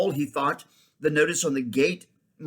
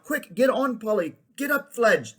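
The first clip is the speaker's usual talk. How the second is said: higher, louder hurried call; urgent shout; 9 semitones higher, 5 dB louder than usual. A narrator's reading. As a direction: shout to friends to get moving. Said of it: "was shouting out".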